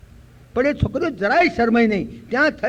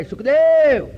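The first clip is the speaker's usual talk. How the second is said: high, loud drawn-out call; 7 semitones higher, 6 dB louder, much slower than usual. Acted. Vyas calls after his fleeing son Sukhdev, shouting. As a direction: shout after a runaway son, stretching the name. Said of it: "જ્યારે બૂમ પાડે".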